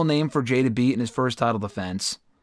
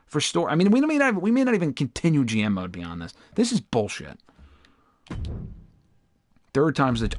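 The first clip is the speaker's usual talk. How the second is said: ordinary talk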